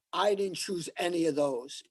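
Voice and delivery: ordinary talk, nothing marked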